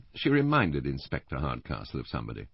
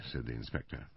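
ordinary delivery

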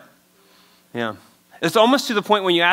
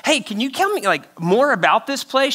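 mock eager friend; 7 semitones higher than usual. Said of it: "he goes".

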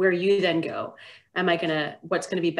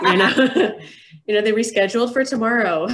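louder, higher, laughing through the words; 5 semitones higher, 7 dB louder than usual. Real, not acted. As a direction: chuckle while giving the fib.

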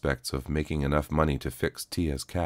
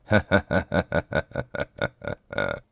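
dry rasping cyborg laugh; a grating mechanical chuckle. Acted. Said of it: "a rasping sound".

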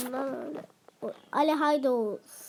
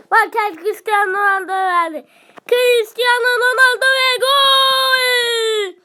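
screaming football commentator; 4 semitones higher, 8 dB louder, slower than usual. Real, not acted. Scores goal in game.

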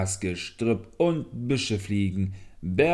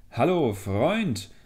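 loud, drawn-out greeting call; hearty, lifted hail; hailing a friend; 6 semitones higher, 5 dB louder, slower than usual. A narrator's reading. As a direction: call out slowly and higher to a friend. Stag hails the leech.

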